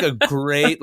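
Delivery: ordinary talk, nothing marked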